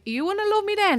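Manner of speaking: high, loud, insistent protest; teen self insisting she was unlovable; emphatic retort. Retold or imitated. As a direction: throw it back, half laughing. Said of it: "I'm like".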